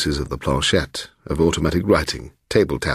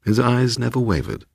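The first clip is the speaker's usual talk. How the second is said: ordinary delivery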